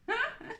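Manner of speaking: short, high laugh; a quick burst of high giggling; delighted giggle. Real, not acted. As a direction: let out a short happy giggle. Delighted with her craft kit.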